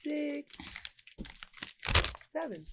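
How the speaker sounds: soft, trailing count; counting under breath. Real, not acted. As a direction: count quietly, almost to yourself. Counts the folds quietly.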